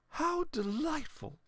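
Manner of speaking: put-on high, slow delighted old lady; a grandmother savouring the pie. A narrator's reading. Grandmother content.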